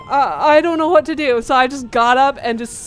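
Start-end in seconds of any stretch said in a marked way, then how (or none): 0.1-1.4 higher, louder retold bewilderment; mock-confused, pitched up; really confused. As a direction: act out being at a loss. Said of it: "I was, like, really confused".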